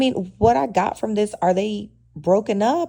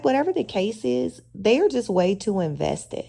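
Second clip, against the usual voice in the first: usual speaking voice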